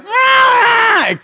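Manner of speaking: put-on a sudden high, loud toddler shriek; imitated baby shriek. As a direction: screech like a baby next to the mic.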